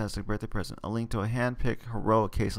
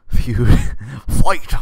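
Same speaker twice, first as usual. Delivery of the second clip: loud, higher shout with a laugh; mock battle cry; 3 semitones higher, 13 dB louder than usual. Real, not acted. Reader joking about the Feud Fund, shouting. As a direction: yell it like a rallying cry.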